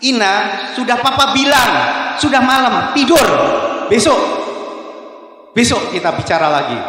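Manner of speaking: put-on loud, high, exasperated shout at the child; angry snap. Tired father yelling at his daughter to go to bed, angry shout. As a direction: bark it like a tired dad losing his temper. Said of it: "ayahnya mulai marah karena dia sudah capek dan dia bentak anaknya".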